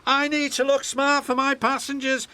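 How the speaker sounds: high, loud and fast, full of himself; vain engine James. Acted. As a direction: rattle it off, too important to help. James's voice held.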